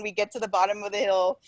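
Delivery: usual speaking voice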